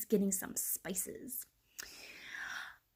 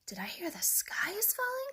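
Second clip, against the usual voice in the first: high, softer duck voice; quacked; 10 semitones higher, 5 dB quieter than usual. A narrator's reading. Duck hearing the alarm, quacking. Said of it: "quacked Ducky Lucky".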